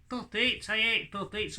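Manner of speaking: high, grumbling puppet; grumpy puppet voice. Acted. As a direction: puppet sulking about puppets. Puppet Hadley complains.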